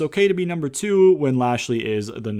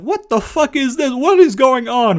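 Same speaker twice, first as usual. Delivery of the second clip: high, loud, stretched-out outrage; outraged yell; 12 semitones higher, 6 dB louder than usual. Retold or imitated. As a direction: yell it like you can't believe who just walked in. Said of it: "He's like".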